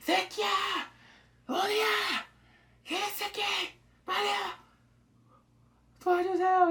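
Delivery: high, loud frantic calling out; panicked cries. Retold or imitated. Blinded man at the crash site, high panicked cries. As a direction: yell for help in panic. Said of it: "He's like".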